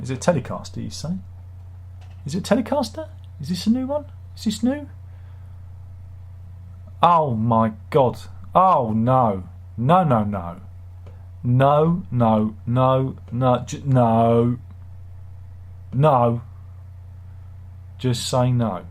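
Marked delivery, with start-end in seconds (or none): 2.2-4.9 mock-innocent questions up high; high squeaky voice. 7.0-16.4 horrified no. Real, not acted. Horrified at the guitar.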